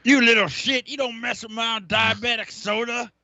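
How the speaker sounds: high, loud hammed-up scolding; mock angry shout. Telling off a kid who messed with the soda, mock outraged shout. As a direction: play at yelling at a naughty kid.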